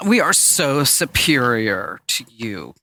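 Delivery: very slow, drawn-out snobbery; hammed-up smug Apple devotee; mock superiority. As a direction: stretch every word with lofty disdain. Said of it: "the Apple would be like".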